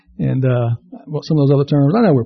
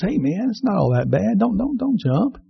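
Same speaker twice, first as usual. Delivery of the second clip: passerby softly begging a man not to jump; gentle pleading; 5 semitones higher, 7 dB quieter than usual. Acted. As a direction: talk him down gently.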